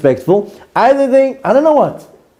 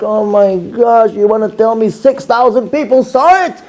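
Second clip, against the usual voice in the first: aghast disbelief; 7 semitones higher than usual. Retold or imitated. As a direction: replay your dismay, stretching the opening. Acted horror at six thousand viewers.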